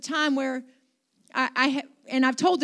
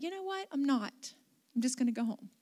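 softly retold private decision; quiet thought; 8 dB quieter than usual. Pastor deciding to herself to skip shopping, quietly. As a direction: think it aloud, softly. Inner decision, then repeated aloud.

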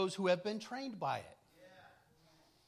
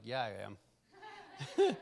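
amused; 5 semitones lower, much slower than usual. Retold or imitated. Dad's knowing dry reply.

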